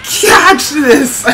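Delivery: high, loud yell with a laugh; excited battle cry. Real, not acted. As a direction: hurl it out gleefully. Final attack called out.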